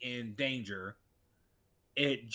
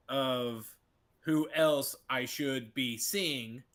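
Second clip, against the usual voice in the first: ordinary delivery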